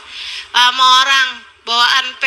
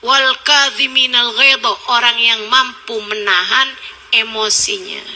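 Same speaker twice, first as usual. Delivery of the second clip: ordinary talk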